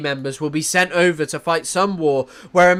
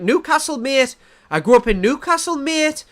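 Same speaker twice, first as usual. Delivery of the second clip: higher-pitched mock Geordie lilt; Newcastle accent; 6 semitones higher than usual. Shown as a demonstration. Showing how a northern family member should sound, Geordie accent. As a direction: put on a broad Newcastle accent. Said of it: "they should sound like this".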